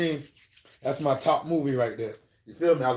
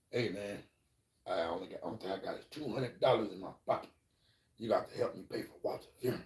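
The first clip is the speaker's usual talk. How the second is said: much softer, lower mumbled plea; pleading quietly; 6 semitones lower, 12 dB quieter, faster than usual. Retold or imitated. Muddy asks Lynn to help pay for the funeral, soft pleading. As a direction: beg under the breath. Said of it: "he goes to Lynn like".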